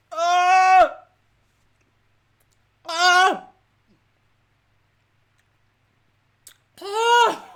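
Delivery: high, loud "oh" cries; repeated wails of real pain; pained moans. Real, not acted. Agony from sour sweets.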